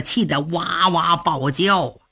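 ordinary delivery

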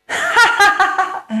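high, hammed-up villain cackle; the bandit laughs off her candlestick, cackling high; villain's mocking laugh. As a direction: cackle with scorn. Villain mocks her weapon.

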